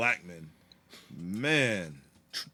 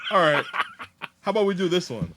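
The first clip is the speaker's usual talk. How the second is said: mock friend voice; 6 semitones higher, 5 dB louder, faster than usual. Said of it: "He was like".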